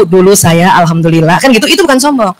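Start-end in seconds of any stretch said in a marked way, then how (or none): 0.0-1.4 lower, modest voice of a proud mother; a mother humbly mentioning her past; humble mother. Shown as a demonstration. A mother telling her success, said humbly. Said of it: "ibu cerita tentang prestasi ibu".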